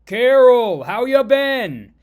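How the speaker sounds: Hulk bellowing hello, high and loud; big, booming, drawn-out yell; screaming greeting. Acted. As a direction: shout it out slowly across the landing field. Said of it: "as Hulk screams".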